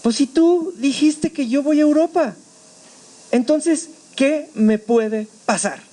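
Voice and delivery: slow, deliberate protest; challenging God. Retold or imitated. Speaker reasons with God in mid-air, slow challenge. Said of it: "yo le decía a Dios".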